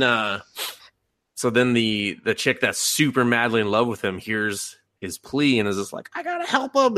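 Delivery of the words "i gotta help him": lovestruck high squeak. Retold or imitated. Lovestruck girl hearing his plea.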